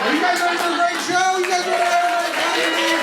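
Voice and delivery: high, fast announcer hype. Acted. Emcee pumping up the crowd before the show, hype voice. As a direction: pump up the crowd. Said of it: "announcer hyping the crowd".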